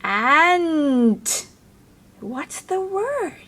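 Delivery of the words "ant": Ant blended tighter, louder; even faster blend. Shown as a demonstration. Teacher says Ant even faster, louder. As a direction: run the sounds of Ant together. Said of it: "Can you say that even faster?".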